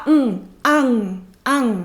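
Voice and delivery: "on, an" said as single clipped syllables; syllable on as one block. Shown as a demonstration. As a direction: say "an" in one block, no French nasal. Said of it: "Et surtout pas un an".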